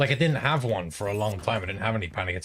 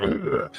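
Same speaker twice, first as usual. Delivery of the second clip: mock monster "ugh"; imitating a video game monster's noise; monster grunt; 6 semitones higher than usual. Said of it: "like, monster noise".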